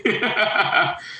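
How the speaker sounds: high giggle; short burst of high chuckles; laugh. Real, not acted. Streamer tickled by his joke about his sister, laughing.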